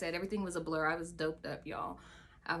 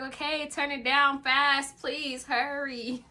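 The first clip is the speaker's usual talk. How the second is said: higher and louder, slow and pleading; urgent plea; 6 semitones higher, 6 dB louder, slower than usual. Retold or imitated. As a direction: beg her to hurry. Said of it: "I was like".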